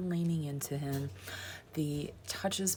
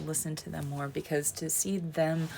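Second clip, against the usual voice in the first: usual speaking voice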